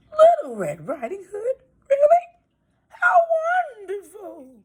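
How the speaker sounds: high, quavering granny voice; a delighted old lady; old grandmother. Acted. Grandmother happily greeting her visitor, high and quavery.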